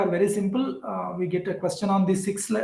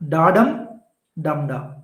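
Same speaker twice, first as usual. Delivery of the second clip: "Da dum" rising, then "dum da" falling lower; alternating stress; 4 semitones lower than usual. Shown as a demonstration. Teacher giving a memory aid, stress swapped between "Da dum" and "dum da". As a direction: chant "Da dum" up, answer "dum da" down. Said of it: "How to remember?".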